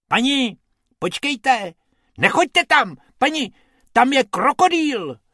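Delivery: high, loud urgent warning; high rabbit warning the lady. A narrator's reading. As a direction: call out to stop her. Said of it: "varoval jí Bob".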